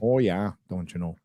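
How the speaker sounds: put-on Minnesota accent. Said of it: "Minnesota accent".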